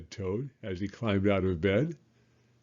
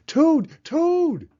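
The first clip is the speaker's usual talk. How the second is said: very high, loud frog call; crying out; 8 dB louder than usual. Acted. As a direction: yell up at the sleeping friend. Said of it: "cried Frog".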